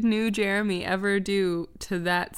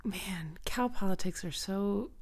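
whispered aside; 8 dB quieter than usual. Real, not acted. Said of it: "whispered aside".

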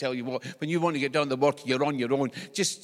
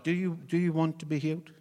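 put-on 1 simple, as to a child; 3 semitones higher than usual. Shown as a demonstration. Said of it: "the way they spoke to a child".

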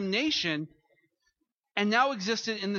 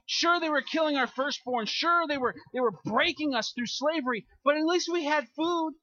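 higher and louder, dripping sarcasm; mock their longing for Egypt, voice raised; sarcastic mock complaint; 7 semitones higher, 3 dB louder than usual. Mocking the Israelites' nostalgia.

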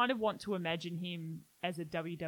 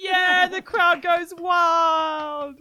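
ring announcer; 9 semitones higher, 12 dB louder, much slower than usual. Acted. Mock announcer after song.

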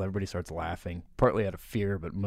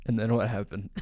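ordinary talk

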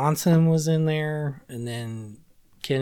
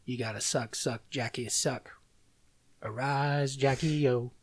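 quiet lyric recitation; 5 dB quieter than usual. Retold or imitated. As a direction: murmur the lines like a dark poem.

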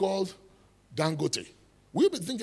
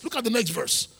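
ordinary delivery

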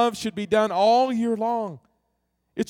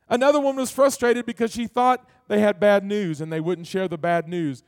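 ordinary talk, nothing marked

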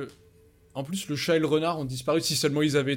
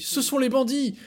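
higher and louder alarmed cry; excited exclamation; 11 semitones higher, 5 dB louder than usual. Acted. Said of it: "puisque Pinocchio nous dit".

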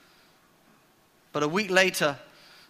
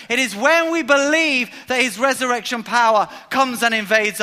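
louder, rising sermon climax; full-force preaching; 5 semitones higher, 6 dB louder than usual. Acted. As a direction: drive it home to the congregation.

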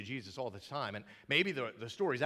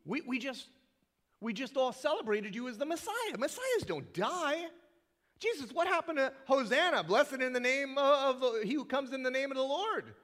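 disciples protesting; 10 semitones higher, 4 dB louder than usual. Retold or imitated. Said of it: "what do you mean she's getting you ready to die".

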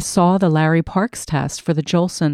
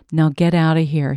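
ordinary delivery

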